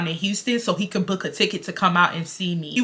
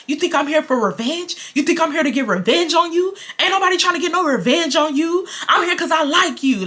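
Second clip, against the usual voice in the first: retold yelling; 7 semitones higher than usual. Man protesting he isn't after revenge, mock yelling. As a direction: imitate his indignant outburst at a raised pitch. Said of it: "he starts yelling at me".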